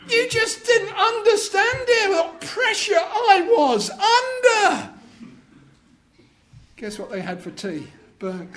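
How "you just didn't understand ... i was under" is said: high, loud whiny excuse, half laughing; whining husband. Acted. Husband excusing the burnt cake to wife, high and whiny. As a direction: plead like a put-upon husband.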